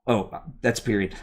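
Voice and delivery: ordinary delivery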